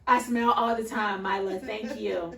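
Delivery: hammed-up offense at a child's remark; indignant retort. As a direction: act put out, a little huffy. Mom retorts to daughter.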